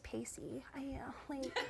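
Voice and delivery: whispered, hesitant celebrity impression; Katie Holmes. Shown as a demonstration. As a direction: murmur it out of the side of the mouth. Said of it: "She goes".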